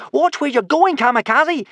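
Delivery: high, loud shout of alarm; alarmed yell. Acted. Hiccup dangling on a rope, yells at Kamikaze. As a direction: yell it at the girl at the wheel.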